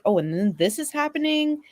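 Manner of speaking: ordinary delivery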